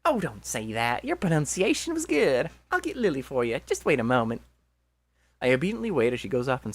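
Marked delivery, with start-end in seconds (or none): 0.0-4.4 hammed-up southern accent for a game character. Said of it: "I'm just gonna do a southern accent".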